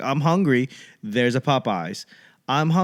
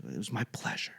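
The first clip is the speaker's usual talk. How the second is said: breathy unvoiced whisper of my pleasure; 4 semitones lower, 15 dB quieter, faster than usual. Comic voicing the worker murmuring its pleasure to a customer. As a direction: hush it out sweetly.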